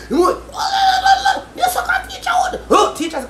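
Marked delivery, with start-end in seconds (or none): 0.5-2.6 high-pitched mimicked outburst; mock wail. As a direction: put on a much higher voice to imitate. Acted quote in a ranting retelling.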